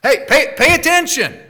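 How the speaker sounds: loud, high urgent call to wake them up; emphatic imperative, rising to a loud, high shout; forceful command. Shown as a demonstration. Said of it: "It's forceful".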